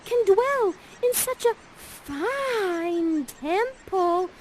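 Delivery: very high, slow, otherworldly voice; airy spirit voice. Acted. Ariel whispering praise of Ferdinand to Miranda, high and slow. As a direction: float the line way up high like a spirit.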